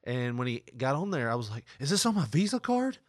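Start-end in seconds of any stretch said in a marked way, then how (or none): 1.8-3.0 high, whiny fake panic about loans; mock frantic debtor. Prank caller fakes panic to telemarketer, high whiny voice. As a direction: play the panicked broke customer, pitched way up.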